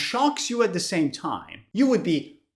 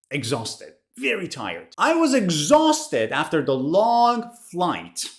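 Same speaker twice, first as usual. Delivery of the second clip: normal talking voice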